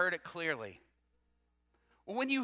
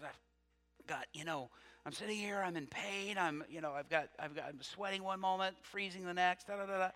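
imitated sore throat; 8 dB quieter than usual. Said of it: "razor blades in my throat".

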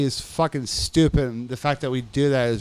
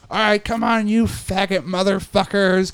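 imitated drill-style order, pitched up; mock tough guard; 5 semitones higher, 4 dB louder than usual. Guard rousing inmates at shakedown, mock tough. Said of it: "how they try to act so tough".

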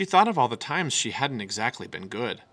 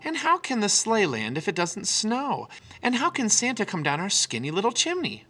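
put-on higher, eager young cub; little cub Sister; 10 semitones higher than usual. A narrator's reading. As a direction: voice the small sister, curious and earnest. Sister voiced by narrator.

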